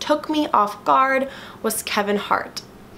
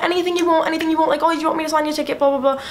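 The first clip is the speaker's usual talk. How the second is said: high-pitched mock British accent; 7 semitones higher, much faster than usual. Singer offers a fan anything she wants, bad British accent. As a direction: imitate an obliging Brit, voice held high. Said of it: "freaking out about my British accent".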